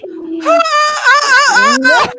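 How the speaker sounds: high, loud repeated shrieks; imitated terrified squeals; mock panicked screaming. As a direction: shriek in bursts, hammed-up fear. Retells fright as man approaches.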